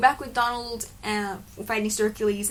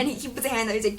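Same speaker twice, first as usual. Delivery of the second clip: normal talking voice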